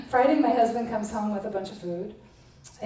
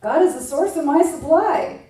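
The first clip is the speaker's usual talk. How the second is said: affirmation; 6 semitones higher, 7 dB louder than usual. Shown as a demonstration. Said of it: "let's do an affirmation".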